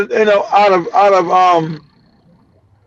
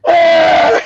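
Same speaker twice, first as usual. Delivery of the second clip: laughing exclamation; 7 semitones higher than usual. Real, not acted. End of laughing fit.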